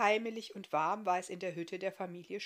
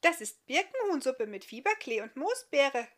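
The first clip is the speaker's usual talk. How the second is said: put-on higher, louder tiny child's voice; little girl's voice; 7 semitones higher, 8 dB louder than usual. A small girl answering the guest, high and bright.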